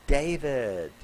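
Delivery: ordinary talk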